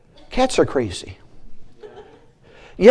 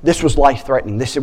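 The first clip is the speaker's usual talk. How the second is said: ordinary delivery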